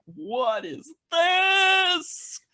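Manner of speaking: high, drawn-out cry of amazement; retold mind-blown yell, slow and soaring; amazed shout. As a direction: blow up the last word, loud and stretched. Retelling childhood amazement at Tintin.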